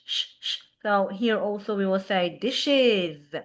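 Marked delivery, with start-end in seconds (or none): 0.0-0.6 unvoiced sh hissed softly, held; teacher hisses sh to show the sound; sh sound demo. Shown as a demonstration. Teacher demonstrating the sh sound to class, soft hiss. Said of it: "the word dish".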